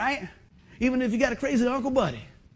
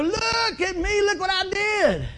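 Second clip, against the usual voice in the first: much higher put-on voice, slow and boastful; preacher mocking folks who want credit, high and boastful; mock show-off; 12 semitones higher, 3 dB louder, slower than usual. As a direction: sneer it like a bragging show-off.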